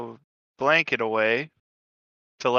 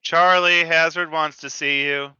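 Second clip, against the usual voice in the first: raised call that eases off; 3 semitones higher, 4 dB louder than usual. Pretend. Head out the door summoning Charlie, calling out. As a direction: holler the name, then pass on the message.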